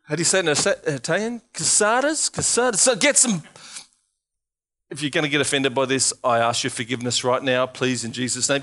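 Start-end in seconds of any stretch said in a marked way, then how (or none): none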